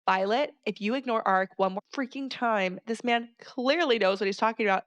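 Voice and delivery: ordinary talk, nothing marked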